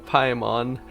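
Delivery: normal talking voice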